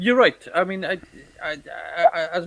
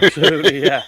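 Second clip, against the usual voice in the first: laughing; 9 dB louder, faster than usual. Real, not acted. Laughing at Scouser joke.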